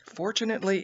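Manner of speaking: plain reading voice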